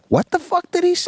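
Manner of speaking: high-pitched incredulous outburst; alarmed father. Acted. Father hearing his kid's news, high-pitched alarm. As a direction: burst out high, like a dad who can't believe it.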